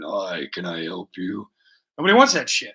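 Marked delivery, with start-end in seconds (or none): 0.0-1.5 lower, slow gruff gorilla trying to be polite; a big hairy brute playing receptionist; gorilla as secretary. Talking to viewers, a hairy gorilla voicing a secretary, slow and low. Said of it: "hairy knuckle gorilla going".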